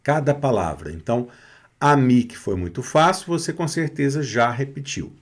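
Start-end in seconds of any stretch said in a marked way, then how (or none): none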